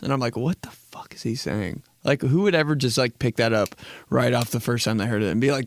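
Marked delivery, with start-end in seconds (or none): 0.4-1.8 hushed, breath-only aside; baffled whisper. Acted. Listener baffled by a Future lyric, puzzled whisper. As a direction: whisper it to yourself in confusion.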